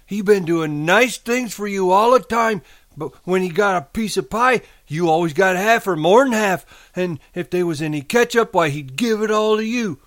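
high, loud scolding in a simple man's voice; Lenny's voice. A narrator's reading. Imagined Aunt Clara reproaching her nephew, high scolding. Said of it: "she went on in Lenny's voice".

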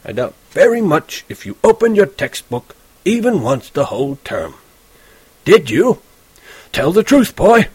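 put-on old teacher grilling a lazy student; stern old teacher. A narrator's reading. Teacher Spencer demanding the truth from Holden, stern.

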